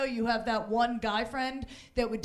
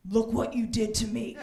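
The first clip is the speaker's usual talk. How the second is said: smug date; 4 dB louder than usual. Retold or imitated. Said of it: "he pulls back and goes".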